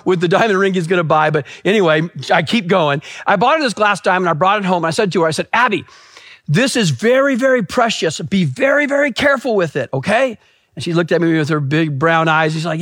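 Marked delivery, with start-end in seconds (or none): 5.5-10.4 put-on higher, earnest parent-to-child voice; lightly acted dad's warning; careful father's warning. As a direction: caution a small child gently but pointedly. Father cautioning little daughter.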